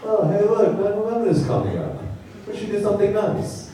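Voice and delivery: high, chipper mock terrorist; lightly voiced friendly terrorist; cheery terrorist. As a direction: sound sweetly helpful. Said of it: "a terrorist slipping through the calendar".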